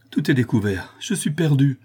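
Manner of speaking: horror-struck, rising on the last words; panicked confession of being lost; terrified cry. A narrator's reading. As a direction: gasp it out in terror. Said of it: "s'écria Louise épouvantée".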